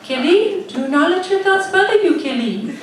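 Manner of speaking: high, loud, laughing mock guru. Comedian mimicking Deepika's advice, high laughing mock guru. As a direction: lay on a lofty guru voice through laughs.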